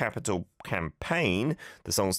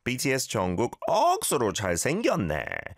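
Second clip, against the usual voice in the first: Busan accent; 5 semitones higher, much faster than usual. Shown as a demonstration. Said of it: "we gotta try to do it with a Busan accent".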